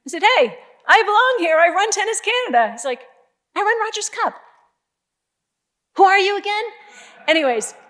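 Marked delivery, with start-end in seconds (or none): 0.2-2.7 high, loud defiant comeback; loud, raised self-assertion, re-enacted; retold defiant retort. Retold confrontation, standing her ground. 3.5-6.6 high, raised retort; re-enacted boardroom challenge, high and loud; retold challenge.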